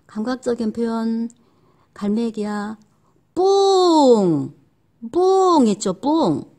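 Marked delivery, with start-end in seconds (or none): 3.3-4.5 long booming ppung; cartoon fart noise; loud fart sound. Shown as a demonstration. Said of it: "소리로 나타내는".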